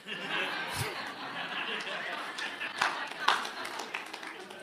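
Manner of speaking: soft breathy chuckle; quiet laugh. Real, not acted. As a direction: laugh at the punchline. Laughs at own punchline.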